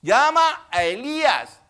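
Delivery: loud, high shout to the crowd; bystanders calling out. Acted. Bystanders at the cross pointing out a cry to Elijah, shouted. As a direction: call it out slowly at full voice. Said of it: "algunos de los que estaban allí decían".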